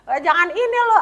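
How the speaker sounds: high, loud taunt; mocking yell. Seniors teasing the new kid, high loud taunting call. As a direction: call out teasingly across the schoolyard. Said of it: "mereka tuh teriak-teriakin gue".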